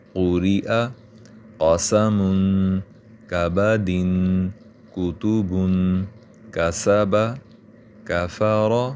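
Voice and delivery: each word read out slowly and clearly for learners; word reading drill. Shown as a demonstration. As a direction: read single words like a Quran teacher. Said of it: "حمزہ زبر آ". (الگ الگ لفظ صاف صاف پڑھنا)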